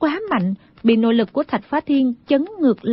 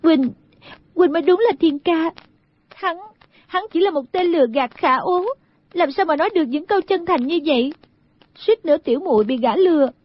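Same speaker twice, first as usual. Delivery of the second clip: put-on higher, halting young woman's voice; tearfully moved; 6 semitones higher, faster than usual. Girl reunited with her true love, mildly tearful. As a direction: stammer the first words, pitch lifted. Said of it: "sa lệ đáp".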